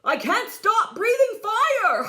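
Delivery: high, loud panic; father panicking as flames spread; panicked. A narrator's reading. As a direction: blurt it out in alarm. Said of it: "Crispin's father panicked".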